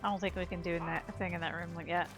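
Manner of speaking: ordinary talk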